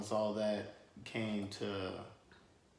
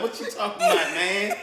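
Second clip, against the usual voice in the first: much higher and louder, laughing; incredulous retort; 12 semitones higher, 11 dB louder than usual. Retold or imitated. [name] reacting to Marcus leaving, loud disbelief. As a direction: exclaim in disbelief. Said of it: "I'm like".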